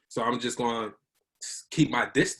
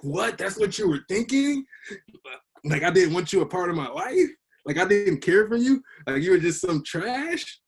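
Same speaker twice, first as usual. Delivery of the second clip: incredulous disbelief; 7 semitones higher, 4 dB louder than usual. Retold or imitated. Retelling his shock at mom's words.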